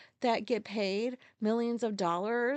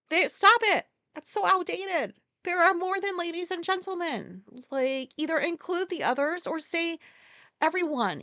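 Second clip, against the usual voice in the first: higher, louder exasperated snap; ranting at announcers, angry and raised; angry outburst; 7 semitones higher, 4 dB louder than usual. Real, not acted. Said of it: "I get so pissed".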